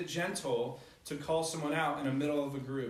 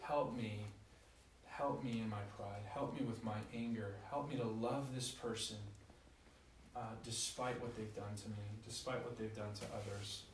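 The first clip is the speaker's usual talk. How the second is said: soft, low, hushed prayer; demonstrating a humble, much softer prayer; quiet prayer; 6 semitones lower, 10 dB quieter than usual. Shown as a demonstration. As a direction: pray it softly, turning inward. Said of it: "stop and go, Lord".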